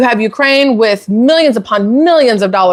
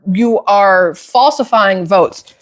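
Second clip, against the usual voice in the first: ordinary talk, nothing marked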